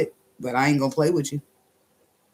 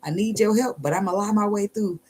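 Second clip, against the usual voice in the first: mock prayer; 7 semitones higher than usual. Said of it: "You can't be talking about".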